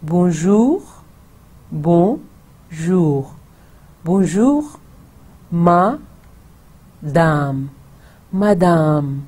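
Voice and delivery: Bonjour and madame in clear French; French model phrase. Shown as a demonstration. Teacher models greeting to a lady. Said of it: "So, you say".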